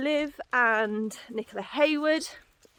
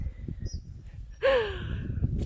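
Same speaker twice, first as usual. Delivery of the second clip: sharp breathless gasp; gasping for air; 10 semitones higher, 4 dB quieter than usual. Real, not acted. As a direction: gasp in, winded from the walk. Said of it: "I sound out of breath all the time".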